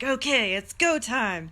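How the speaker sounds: loud, excited go signal; pumped. Retold or imitated. Streamer psyching herself up to go live, loud and excited. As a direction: fire yourself up, ready to launch. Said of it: "I'm like".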